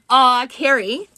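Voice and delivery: usual speaking voice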